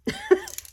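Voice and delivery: short high squealing laugh; a bright burst of laughter at yourself. Real, not acted. Laughs about old sponge.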